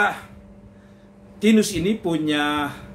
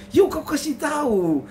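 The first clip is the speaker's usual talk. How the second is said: eager husband; 9 semitones higher than usual. Acted. Tinus urges again.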